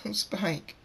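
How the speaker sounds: high, softened, grieving cry. A narrator's reading. Twilight drops to her knees grieving for Spike, soft high crying. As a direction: call the lost one's name in grief. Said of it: "drops to her knees crying and says".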